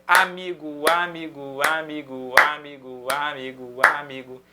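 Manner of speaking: "amigo" chanted evenly over and over, each syllable stretched; ripetizione ritmica. Shown as a demonstration. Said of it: "Ou então a palavra amigo".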